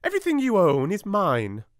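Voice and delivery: haughty schoolmistress gloating; stern headmistress. A narrator's reading. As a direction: lay claim like a cruel headmistress. Miss Minchin claiming Sarah's things.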